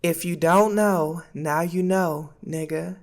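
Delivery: lightly voiced Kamala Harris, slow and soft; a faint hint of Kamala Harris, drawn out. Vlogger mimicking Kamala Harris for his viewers, lightly. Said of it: "in the voice of Kamala Harris".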